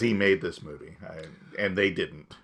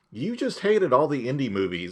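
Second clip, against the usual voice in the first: indignant retort; 7 semitones higher than usual. Retold or imitated. Acted retort to the same friend.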